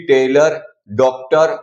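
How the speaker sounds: टेलर and डॉक्टर ending in a short "ur"; slow टेलर, डॉक्टर with a neutral uh before the r; schwa endings. Shown as a demonstration. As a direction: say टेलर as "TAY-lur", डॉक्टर as "DOK-tur". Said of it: "ओ को हमने अ pronounce किया है".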